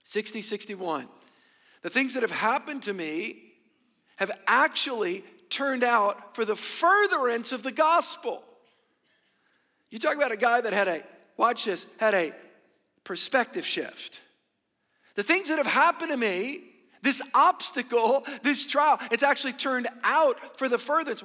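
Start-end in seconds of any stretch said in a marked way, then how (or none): 1.8-8.4 preaching. Acted. Preacher reads Paul's words. 15.1-21.2 loud, higher preaching voice; preaching at full force. Acted. Preacher voicing Paul, rising.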